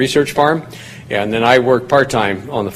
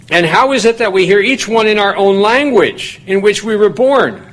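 raised, ringing Bible reading; scripture reading; 8 semitones higher than usual. Acted. Preacher reading Acts to the congregation, raised and ringing. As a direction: read the verses out to the congregation with lift.

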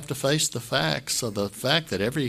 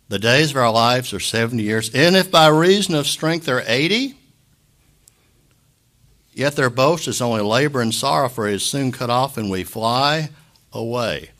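loud, declaiming psalm reading; scripture reading; 7 dB louder than usual. Acted. Pastor reading the psalm aloud to the mourners, declaiming loudly. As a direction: proclaim the verse to the room. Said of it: "Psalm ninety, verse ten".

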